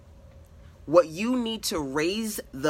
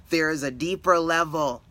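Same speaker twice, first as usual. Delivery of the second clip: ordinary delivery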